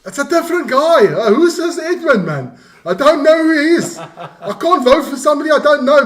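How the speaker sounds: high, loud, laughing protest; mock outraged rant. Co-host ribbing the host over the wrong name, high mock outrage.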